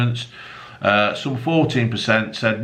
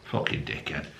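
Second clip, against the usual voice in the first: muttered insult; 9 semitones lower, 12 dB quieter than usual. Real, not acted. Insulting the boyfriend under breath.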